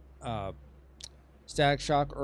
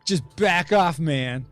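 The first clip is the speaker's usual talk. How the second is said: loud, higher-pitched hammed-up menace; super menacing; 6 semitones higher, 11 dB louder than usual. Game master voicing the golem facing its attacker, super menacing. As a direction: act out an indignant, threatening golem. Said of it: "look at you super menacingly".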